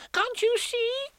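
high squeaky shout; a tiny pig yelling. A narrator's reading. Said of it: "shouted Piglet".

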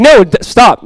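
loud, high cutting-in objection; exasperated rebuke. Acted. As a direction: cut in to put a stop to it. Acted rebuke in sermon.

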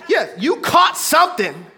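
preacher shouting it out; preaching shout. Acted. Preacher shouting the point to the church. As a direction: shout you caught something.

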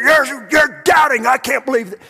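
hammed-up furious Jesus; mock angry shout. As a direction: berate him in disbelief. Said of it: "Is that the way he said it".

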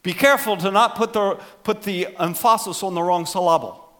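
"emphasis" said em-FAH-sis, "syllable" said suh-LAH-bul; misplaced word stress. Shown as a demonstration. Pastor quoting his professor's joke, "emphasis" and "syllable" stressed mid-word. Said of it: "professor at Columbia who used to say this".

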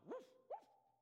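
high playful woof woof; hammed-up dog barking; dog barks. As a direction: bark like a friendly dog. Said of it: "And the dog goes".

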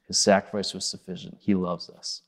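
usual speaking voice

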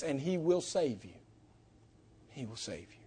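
low, very soft preaching voice; hushed, falling promise; quiet assurance. Real, not acted. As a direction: drop almost to nothing to reassure. Soft assurance after raised appeal.

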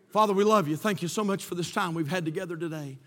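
usual speaking voice

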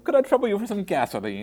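high, loud, affected politeness; exaggeratedly genteel customer; mock proper customer. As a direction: ham up a refined request in a high voice. Joking as an over-proper customer.

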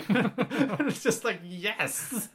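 high laughing exclaim. Real, not acted. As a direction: laugh through the words. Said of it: "laughing while talking".

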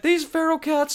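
over-the-top Australian impression of an angry wildlife host; terrible Australian accent. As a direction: ham up a bad Aussie accent, pitched high. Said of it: "That's my terrible Australian accent".

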